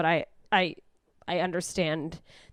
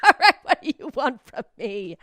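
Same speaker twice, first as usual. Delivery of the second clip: louder, impatient friend; exasperated friend; 3 semitones higher, 10 dB louder than usual. Retold or imitated. Annoyed friend to depressed pal, louder exasperation. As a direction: snap back in annoyance. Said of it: "they're gonna be like".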